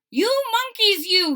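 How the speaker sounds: high, loud scolding shout at the monkeys; scolding peddler. Acted. Peddler scolding the monkeys in the tree, high loud scold. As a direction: wag a finger and scold them high and loud. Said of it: "he said, shaking a finger".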